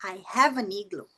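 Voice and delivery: smooth "I hava nigloo"; linked rhythm. Shown as a demonstration. Teacher repeating the connected version, linked. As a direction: run "have an igloo" together as connected speech. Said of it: "o ritmo da fala mudou".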